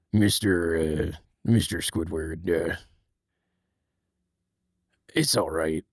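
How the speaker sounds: low, soft, earnest crab boss; deep and serious, coaxing an employee back; serious Mister Krabs. Retold or imitated. Mister Krabs gently asking Squidward back to work, low. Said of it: "I can only do Mister Krabs when he's, you know, talking very serious".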